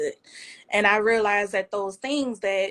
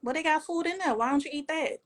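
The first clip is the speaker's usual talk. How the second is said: higher, softer needling question; mock puzzled relative; 6 semitones higher, 5 dB quieter, faster than usual. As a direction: play the family member poking fun. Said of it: "And they be like".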